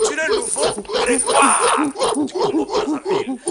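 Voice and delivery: loud, high jeering shout; mocking shouted proclamation. Mock proclamation caging Sassou as ape.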